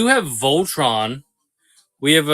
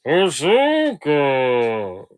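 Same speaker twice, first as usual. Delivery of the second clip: slow, drawn-out character voice; put-on voice for a guessing game; Star Wars character voice; much slower than usual. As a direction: stretch every word out like a sci-fi creature. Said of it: "same impression for both of them".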